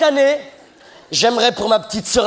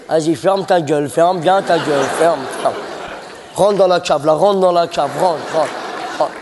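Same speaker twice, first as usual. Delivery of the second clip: lightly voiced bossy brothers; 6 semitones lower than usual. Brothers voiced bossing her.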